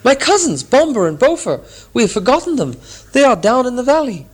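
high, loud panicked call; anguished cry. Acted. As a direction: cry out for the lost cousins. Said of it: "Bifur gave a cry".

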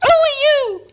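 very high, loud squeaking purse; talking purse squeaks in alarm. Acted. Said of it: "it squeaked".

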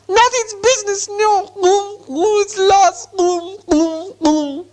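very high creature wail; shriek, then trail into high moans; shrieking Gollum. A narrator's reading. Gollum guards his secret and mourns, shrieking. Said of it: "shrieked Gollum".

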